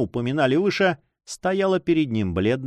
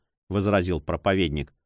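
ordinary delivery, nothing marked

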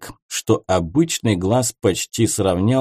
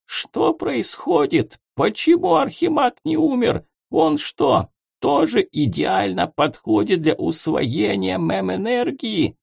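much higher, frantic questions; horrified homunculus; 9 semitones higher than usual. A narrator's reading. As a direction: panic at the screen. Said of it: "в ужасе глядел".

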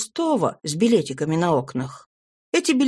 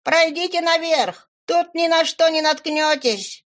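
higher call from above; calling from upstairs; 9 semitones higher than usual. A narrator's reading. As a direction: call down the stairs to them. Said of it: "крикнула им откуда-то сверху".

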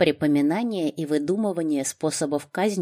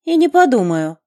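defiant refusal; 9 semitones higher, 9 dB louder than usual. A narrator's reading. Хейт refuses drink.

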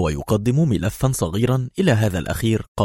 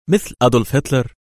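mocking little question, pitched up; sarcastic; 4 semitones higher, 3 dB louder than usual. A narrator's reading. Woman provokes the ambassador about his great leader, sarcastic. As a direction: needle him lightly with the comparison. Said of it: "قالت في سخرية".

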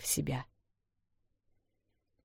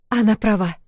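higher and louder, resolute; firm statement; 5 semitones higher, 5 dB louder than usual. Acted. Эл deciding to stay, firm voice. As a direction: state it with finality. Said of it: "твёрдо сказала Эл".

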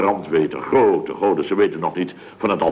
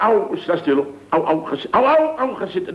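high pained yelps of au; yelping in pain; 8 semitones higher than usual. Acted. Man yelping in pain as the earring pulls his nose. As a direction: yelp each au higher as it tugs.

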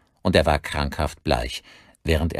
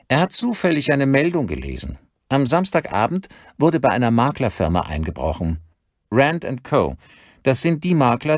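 higher-pitched, animated reporting voice; detective colleague on the phone; 7 semitones higher than usual. Acted. Colleague tells the detective about a burglary, higher-pitched and animated. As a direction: lay out the discovery with lively emphasis.

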